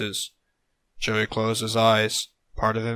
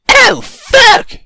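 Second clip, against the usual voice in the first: loud, high yelled curse; startled exclamation; 15 dB louder than usual. A narrator's reading. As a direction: cry out in alarm, very high. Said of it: "Joey exclaimed in the hollow cavern".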